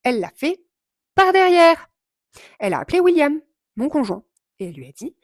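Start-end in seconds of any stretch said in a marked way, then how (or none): 1.1-1.9 par derrière shouted, much higher and louder; stress on par derrière. Real, not acted. Vlogger telling viewers about the clerk, shouting par derrière in outrage. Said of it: "elle n'a pas osé me déglinguer la tête en face".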